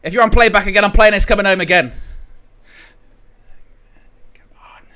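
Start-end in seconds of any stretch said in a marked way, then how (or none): 4.3-4.8 tense whispered plea. Real, not acted. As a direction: breathe it out silently. Hushed plea near the final whistle.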